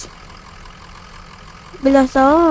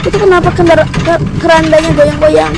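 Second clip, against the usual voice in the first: alarmed; 2 semitones higher, 4 dB louder than usual. Real, not acted. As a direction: ask it nervously and loud. Coffin shaking in car.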